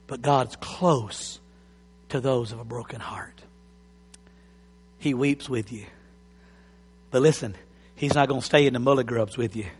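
soft, low and hushed consolation; tender comfort. Real, not acted. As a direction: gently comfort the brokenhearted. Tender pastoral comfort to hurting.

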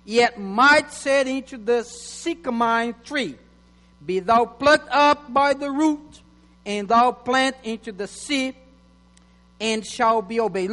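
measured preacher's scripture read. Acted. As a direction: proclaim the verses to the church. Reads scripture aloud to church.

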